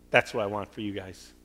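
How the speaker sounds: ordinary talk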